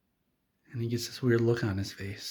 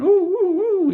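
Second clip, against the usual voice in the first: loud falsetto whooping; frightened oohs; 9 dB louder, slower than usual. Acted. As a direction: shriek "ooh" as if a mouse ran underfoot. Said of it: "And they're all going".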